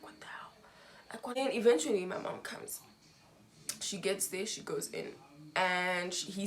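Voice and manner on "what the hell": muttered inner thought. Retold or imitated.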